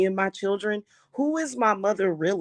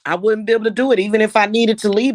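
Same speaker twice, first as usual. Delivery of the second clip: louder and faster, venting frustration; loud fast outburst; 5 semitones higher, 8 dB louder, faster than usual. Real, not acted. Ranting to viewers about being trapped, a loud fast outburst. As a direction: blurt it out hard, fast and loud.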